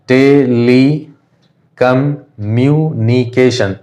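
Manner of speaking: Telecommunication slowly in partly joined syllables, te-li, com, myu, ni-ke-shan; teacher gradually joining the beats of telecommunication. Shown as a demonstration. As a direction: sound out telecommunication in slow chunks with short pauses. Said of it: "distinguish करके देख लेते हैं".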